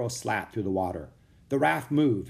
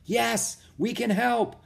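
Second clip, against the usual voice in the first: high, carrying reply; calling out; 11 semitones higher, slower than usual. A narrator's reading. Woman offers help to the raft, calling out. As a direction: call back reassurance from afar. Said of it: "the woman called".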